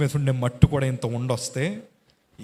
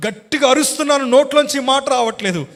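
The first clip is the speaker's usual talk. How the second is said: much higher and louder anguished preaching; preaching at full force; 12 semitones higher, 7 dB louder than usual. Acted. Preacher reliving hospital agony before congregation, loud anguished cry. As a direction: cry it out as if the pain were now.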